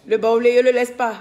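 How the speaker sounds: loud, playful declaration; loud teasing. Real, not acted. Teasing a friend live, loud and playful. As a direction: announce it loudly to tease.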